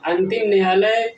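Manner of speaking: ordinary talk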